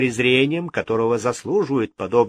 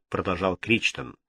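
usual speaking voice

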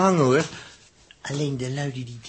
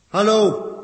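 calling out; 9 semitones higher, 9 dB louder than usual. Acted. Calling into empty ship.

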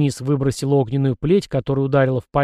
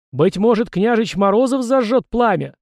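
high, raised showman's question; announcer's call; 8 semitones higher, 4 dB louder than usual. A narrator's reading. Blogger at the microphone singling out the prince, calling out. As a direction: pitch it up like a host addressing a crowd.

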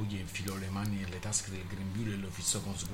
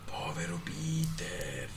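slow, sneering pity; mock him from the wall; 8 semitones higher, slower than usual. A narrator's reading. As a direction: voices in the wall jeer at Peter. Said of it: "lo sbeffeggiarono dal muro".

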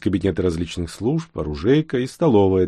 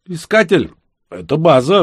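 police radio; 10 semitones higher, 8 dB louder than usual. A narrator's reading. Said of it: "раздался в эфире голос".

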